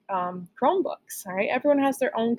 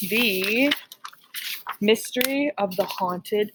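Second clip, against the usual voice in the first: spooky title reading; much slower than usual. Acted. Announcing the spooky play title.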